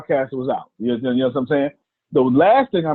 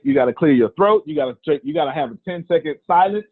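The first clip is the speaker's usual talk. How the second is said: ordinary talk, nothing marked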